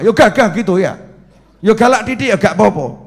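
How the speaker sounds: ordinary delivery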